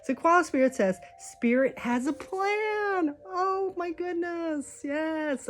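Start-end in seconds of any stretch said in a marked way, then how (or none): 1.2-5.5 high, drawn-out thrill; slow, high-pitched joyful amazement; awed delight. Real, not acted. Thrilled by the card's message.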